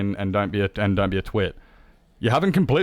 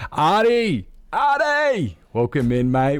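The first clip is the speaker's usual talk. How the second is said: high, loud, joyful calling of a name; excited call; 6 semitones higher, 5 dB louder, slower than usual. Real, not acted. Welcoming a raider on stream, excited shout. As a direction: shout the name to greet a friend.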